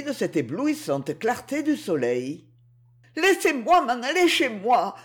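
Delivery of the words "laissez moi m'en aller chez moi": high-pitched pleading cry; distressed girl calling to be let go. A narrator's reading. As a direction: cry out in protest, pitched well up. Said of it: "criait Proserpine".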